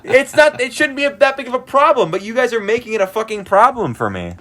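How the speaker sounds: very high-pitched, worked-up protest; heated, exasperated complaint; exasperated rant. Retold or imitated. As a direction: protest with the voice pushed way up. Rant at end of retold quarrel.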